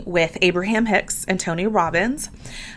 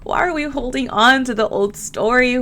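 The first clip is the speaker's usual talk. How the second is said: normal talking voice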